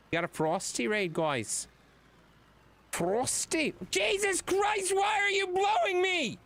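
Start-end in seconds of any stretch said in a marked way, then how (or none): 2.9-6.4 bad British accent. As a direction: overdo a bad British accent, yelling at the wind. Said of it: "I don't know why I'm talking like this".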